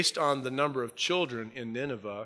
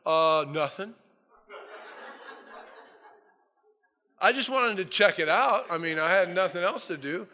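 evasive Jonah; 6 semitones higher than usual. Retold or imitated. Jonah dodging the question.

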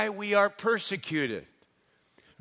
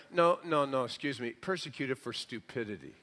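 low, much softer interjected correction; dry correction; 4 semitones lower, 6 dB quieter than usual. Acted. Preacher answering the complainers, dry correction. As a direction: cut in quietly, voice sinking low.

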